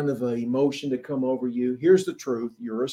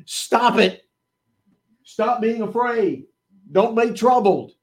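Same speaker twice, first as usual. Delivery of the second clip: higher, louder firm command; Jesus commanding; 7 semitones higher, 4 dB louder than usual. Acted. Jesus telling a troubled believer, a stern command. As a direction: stop it, said like a firm order. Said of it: "He's saying this".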